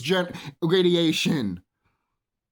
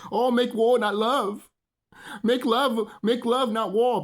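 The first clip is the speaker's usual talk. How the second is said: higher, mimicking slogan voice; mock peacenik sloganeering; 6 semitones higher, slower than usual. Imitating desensitized people saying make love not war, mocking. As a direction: parrot the slogan in a raised mock voice.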